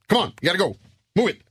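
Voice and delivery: high, urgent action-hero orders; brusque. Shown as a demonstration. Action hero rushes his team to the chopper, brusque. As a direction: fire off curt movie commands. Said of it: "Brysk dialekt".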